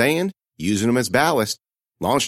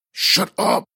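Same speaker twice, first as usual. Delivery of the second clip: breathy hiss, urgent; 9 semitones higher than usual. A narrator's reading. Hiding girl hushing the one betraying her, a hiss. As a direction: hiss it so nobody hears. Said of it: "I hissed".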